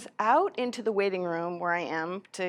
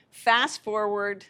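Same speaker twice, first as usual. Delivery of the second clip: ordinary talk, nothing marked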